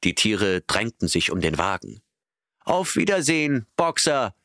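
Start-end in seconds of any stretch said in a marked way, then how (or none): 2.6-4.3 high, called-out goodbye; calling farewell. A narrator's reading. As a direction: call after the cart cheerfully. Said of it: "riefen sie im Chor". (hoher Abschiedsruf)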